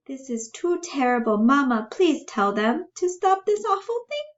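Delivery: high, distressed character voice; rising high-pitched appeal; pleading Bella. Acted.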